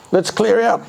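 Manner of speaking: a thief hurrying his mate along; burglar voice. A narrator's reading.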